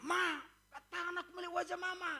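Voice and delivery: put-on high, quick little child asking mama; child's voice. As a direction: play the small child, eager. Child voiced speaking to mother.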